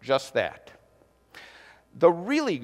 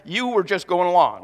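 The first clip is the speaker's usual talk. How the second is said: loud, emphatic mock objection; congregation correcting him; 8 dB louder than usual. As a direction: call out the correction, punching each phrase. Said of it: "supposed to correct me and say".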